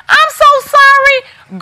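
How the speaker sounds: very high, drawn-out mock-pitiful sorry; exaggerated apology. Seller commiserating over stuck closed captions, exaggerated high apology. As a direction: sing out the apology slowly, way up high.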